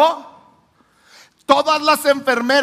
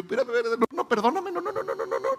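frantic pleading; 8 dB quieter, much faster than usual. Retold or imitated. Youth leader begging the angry boy to calm down, frantic pleading. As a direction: beg him to stop, voice climbing.

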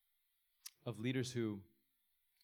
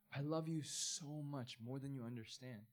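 slow, softer, fading to near hush; tender love; 6 dB quieter than usual. Acted. God to those who hate themselves, slow tender reassurance. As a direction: say it slowly, like a whispered vow.

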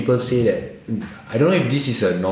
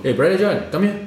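sergeant's call; 10 semitones higher, much faster than usual. Retold or imitated. Imitating the Malay sergeant calling a recruit over. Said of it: "Malay sergeant".

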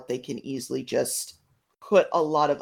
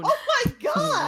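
shocked shout; slower than usual. Real, not acted. Shocked outburst at pee joke.

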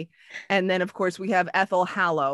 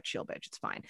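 a whispered brush-off; 14 dB quieter than usual. Retold or imitated. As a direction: whisper it like a cool friend. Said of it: "It's like".

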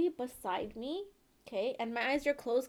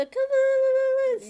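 excited; 14 semitones higher, 10 dB louder than usual. Real, not acted. Hyping herself up.

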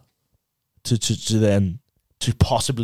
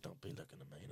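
mock whisper; 7 semitones lower, 32 dB quieter than usual. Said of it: "And he's like".

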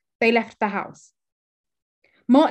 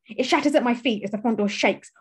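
usual speaking voice

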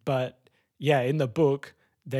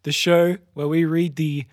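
louder, higher announcer read; podcast intro; 3 semitones higher, 5 dB louder than usual. Acted. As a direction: open the show like a host. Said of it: "show intro".

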